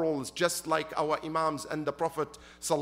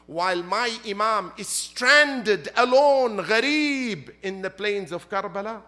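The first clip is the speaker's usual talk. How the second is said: defiant cry; 3 semitones higher, 7 dB louder than usual. Acted. Abbas refusing Shimr's pledge of safety, loud defiant cry. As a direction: let Abbas's loyalty burst out in defiance.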